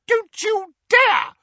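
very high, loud, slow squeal; aunt squealing at Harry. A narrator's reading. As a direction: squeal in outrage. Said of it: "squealed Aunt Petunia".

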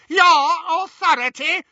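very high, loud, scornful echo; sneered. A narrator's reading. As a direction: throw it back in shrieking scorn. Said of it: "she sneered".

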